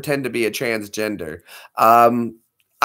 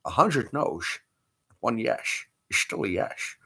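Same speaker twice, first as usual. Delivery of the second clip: Sean Connery; 5 semitones lower, 5 dB quieter than usual. Retold or imitated. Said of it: "Sean Connery".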